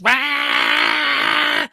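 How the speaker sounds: low, growled engine rah; engine roar. Retold or imitated. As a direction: imitate a clapped-out engine. Imitating a loud engine.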